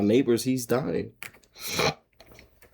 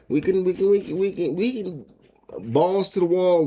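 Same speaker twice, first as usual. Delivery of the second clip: hyped up; 7 semitones higher than usual. Real, not acted. Hyped about making it work.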